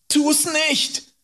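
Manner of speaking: very high, pleading cry; terrified scream. A narrator's reading. Boy begs man with knife not to kill, screaming. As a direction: scream in panic for your life. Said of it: "schrie ich".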